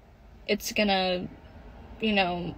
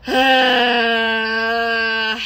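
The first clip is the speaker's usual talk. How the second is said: loud, pent-up scream that breaks toward a cry; frustrated scream; 6 dB louder than usual. Real, not acted. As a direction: let the built-up anger burst out in one loud cry-scream. She says screaming would make her cry.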